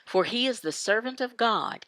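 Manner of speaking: ordinary talk, nothing marked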